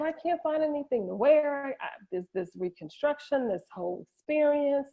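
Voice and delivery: imitating a high-pitched, upset complaint; mildly acted distress, voice lifted high; retold upset. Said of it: "and I was so upset".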